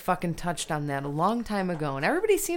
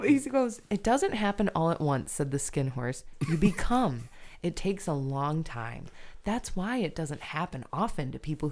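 story reading; 2 semitones lower, 4 dB quieter than usual. Acted. Reading the Skin Horse's words to Nick, storybook voice. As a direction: read tenderly like to a child.

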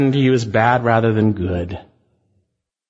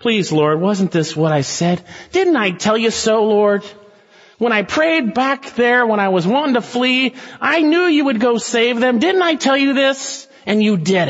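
Jonah complaining; 9 semitones higher than usual. Retold or imitated. Jonah's angry prayer retold.

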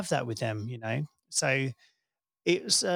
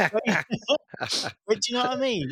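laughing; 9 semitones higher, much faster than usual. Real, not acted. Laughing after spoiler joke.

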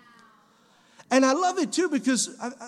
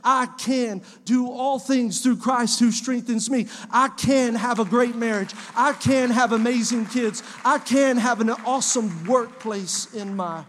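loud, slow, confident proclaiming; faith-filled shout over the congregation; bold declaration; 4 dB louder, slower than usual. Retold or imitated. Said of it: "what if we said".

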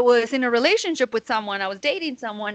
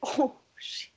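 a stunned, breathy whisper under the breath; shocked whisper; 8 dB quieter than usual. Retold or imitated. Walking in on her kissing the stripper, whispered shock. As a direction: whisper it like you just walked in on something.

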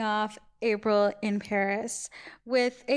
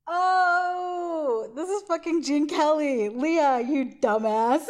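retelling a sudden realization, higher and louder, with a laugh at the end; 5 semitones higher, 6 dB louder, slower than usual. Retold or imitated. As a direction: call yourself a dumbass, laughing at yourself. Retold realization, scolding herself.

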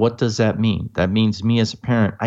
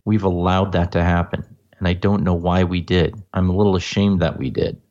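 ordinary talk

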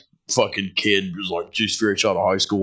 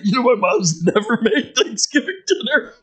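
much higher, pitiful little voice; mock kid voice; 12 semitones higher than usual. Imitating the coworker's son about his mom's cooking. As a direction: squeak it out sadly. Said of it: "He's like".

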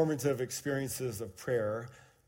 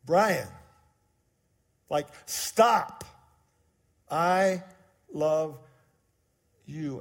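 firm, louder and deliberate with pauses; firmly; 3 semitones higher, 7 dB louder, much slower than usual. Retold or imitated. God's voice firmly stopping Brian, deliberate. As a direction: stop him and say it plainly. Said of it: "this time much more firmly".

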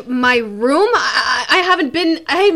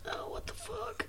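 whisper; 25 dB quieter than usual. Real, not acted. Player reacts under breath.

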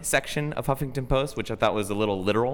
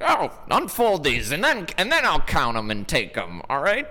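higher put-on voice of a fussy clerk; mock the police teller pitched up; mimicking the teller; 7 semitones higher than usual. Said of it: "he was like".

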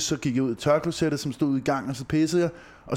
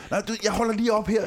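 imiterer drengene; 7 semitones higher than usual. Retold or imitated. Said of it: "så kunne jeg bare høre dem derude".

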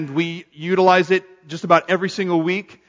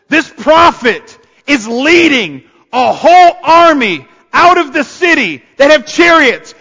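rising, louder, voice nearly breaking with awe; overwhelmed exclaim; 7 semitones higher, 5 dB louder than usual. Real, not acted. Pastor overwhelmed by the story, voice breaking with awe. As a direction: let the wonder crack the voice as it climbs.

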